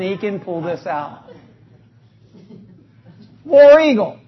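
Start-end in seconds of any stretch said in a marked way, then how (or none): 3.5-4.2 loud "War Eagle" fan cheer; demonstrating the fan cheer; cheer demo. Shown as a demonstration. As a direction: belt it like a stadium chant. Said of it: "for the demonstration".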